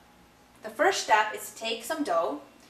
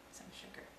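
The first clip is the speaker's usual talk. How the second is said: whispering; 22 dB quieter than usual. Real, not acted. Whispered aside while adding sugar.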